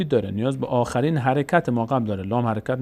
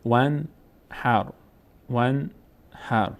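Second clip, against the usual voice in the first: each syllable drawn out, pause between; slow syllable-by-syllable reading; much slower than usual. Shown as a demonstration. Teacher models exercise words for students, slow syllable-by-syllable reading. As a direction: sound it out slowly for learners to repeat.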